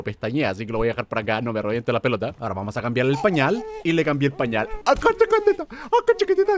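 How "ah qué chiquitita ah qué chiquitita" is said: put-on high squeaky cooing at a baby; gushing high baby-talk voice. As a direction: coo it up high like to an infant.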